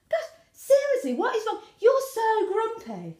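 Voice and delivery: high, loud mock exasperation; exasperated partner. Partner pestering a grumpy woman, mock exasperated. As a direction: nag in an exaggerated high voice.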